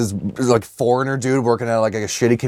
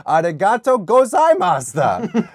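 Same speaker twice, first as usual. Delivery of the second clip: put-on slow, high American-accented Japanese thanks; foreigner's bad Japanese; 8 semitones higher than usual.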